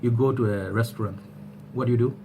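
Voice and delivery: ordinary delivery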